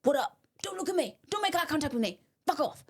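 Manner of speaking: acting hard and hostile; angry teen. Teen walking around school, warning everyone off, angry. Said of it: "I was walking around angry being like".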